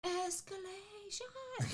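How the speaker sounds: anime heroine. Pretend. Anime transformation phrase, high and slow.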